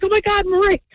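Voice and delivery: high-pitched, ecstatic retelling of a lottery winner; imitating excitement. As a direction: squeal it like someone who thinks he just won big. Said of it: "he's all excited".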